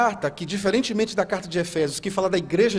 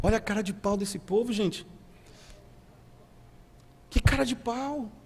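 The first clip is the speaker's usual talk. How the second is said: incredulous aside; 3 semitones higher, 6 dB quieter than usual. Real, not acted. Preacher's aside mocking the people's nerve.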